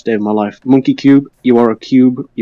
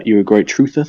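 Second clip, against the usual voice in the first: ordinary delivery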